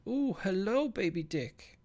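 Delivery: high put-on silly voice; game character greeting. Recalling a game character greeting the player, in a high silly voice. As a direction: chirp it out like a cheeky little game sprite. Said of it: "they went".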